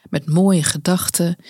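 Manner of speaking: usual speaking voice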